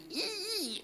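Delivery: high, slow, breaking squeal; imitating dad's squeak. Son imitating his crying dad's squealing voice. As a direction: squeeze the voice up into a thin crying squeak. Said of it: "pretty soon he's squeaking".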